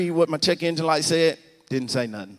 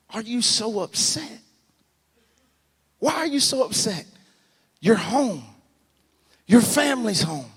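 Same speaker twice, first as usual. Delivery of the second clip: high-pitched exhortation, growing more forceful; preaching, building; 10 semitones higher, 4 dB louder than usual. Acted. Preacher reasoning with himself about being upset, building.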